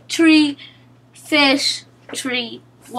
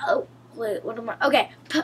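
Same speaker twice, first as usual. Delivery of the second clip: normal talking voice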